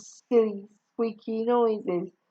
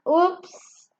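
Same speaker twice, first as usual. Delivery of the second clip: false oops; 8 semitones higher than usual. Acted. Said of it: "I cried falsely".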